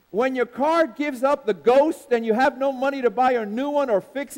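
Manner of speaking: loud rhythmic preacher cadence; preaching. Acted. Preacher rousing the congregation, loud and rhythmic. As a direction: preach it out to the church, full force.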